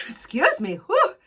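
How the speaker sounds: catching breath after sneezing; sneezy, breathless apology; after a sneeze. Real, not acted. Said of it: "I'm sneezing".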